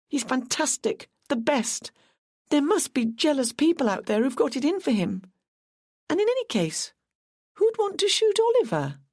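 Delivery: put-on pitched well up, gushing young girl; a young fan, voice climbing high; eager young Carla. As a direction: rush out the praise, high and breathless with devotion. Carla gushes about Brendan.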